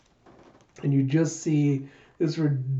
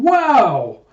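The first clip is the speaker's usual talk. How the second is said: high, drawn-out wow, much louder; loud exclamation; 10 semitones higher, 9 dB louder than usual. Retold or imitated. Jayha exclaiming over the wanted poster, loud exclamation. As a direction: belt out an acted exclamation.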